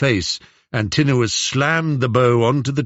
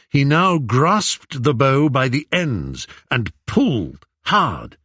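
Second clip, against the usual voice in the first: usual speaking voice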